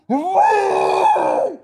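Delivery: long, loud high-pitched howl; drawn-out imitation shriek; imitated screaming. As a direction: wail wildly like someone screaming outside. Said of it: "And then I just hear".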